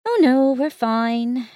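strained, slow, teeth clenched; through gritted teeth. Acted. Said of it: "through gritted teeth".

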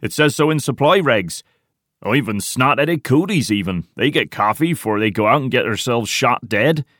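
indignant argument. A narrator's reading. Tiny backs Porter on coffee rights, indignant. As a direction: argue hotly for your rights.

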